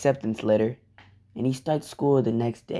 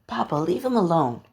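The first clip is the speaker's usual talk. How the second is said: put-on higher-pitched child's plea to papa; lightly voiced small girl begging; little girl pleading; 5 semitones higher than usual.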